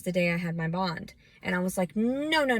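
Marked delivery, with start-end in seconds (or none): 2.0-2.6 retold panicked protest. Retold panic at being left there.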